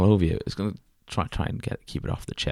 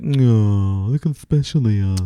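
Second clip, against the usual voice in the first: cooing; 2 semitones higher, 5 dB louder, slower than usual. Retold or imitated. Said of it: "and just be like".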